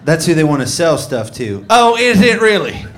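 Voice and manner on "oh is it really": put-on sarcastic drunk woman.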